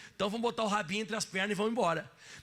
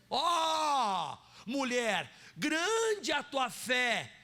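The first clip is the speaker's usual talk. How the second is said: proclaiming shout; 2 semitones higher, much slower than usual. Retold or imitated. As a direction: call out Jesus' praise to the woman. Preacher proclaims Jesus' words.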